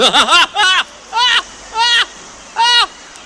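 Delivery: a burst of high, loud laughter; high loud cackle. Acted. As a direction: cackle at your own pun. Laughs at own joke.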